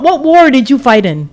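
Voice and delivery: ordinary talk, nothing marked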